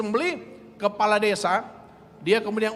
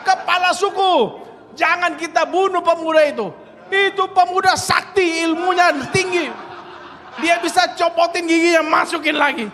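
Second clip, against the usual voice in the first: higher, louder, breathless with laughing; alarmed report; 6 semitones higher, 4 dB louder than usual. Retold or imitated. Spying villagers rushing to warn the chief, loud excited. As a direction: burst in with the astonishing news.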